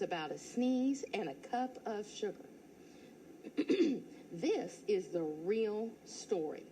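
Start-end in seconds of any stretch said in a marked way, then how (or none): none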